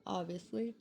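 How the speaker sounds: talking through a yawn. Real, not acted. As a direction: say it mid-yawn. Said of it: "I'm gonna continue to yawn".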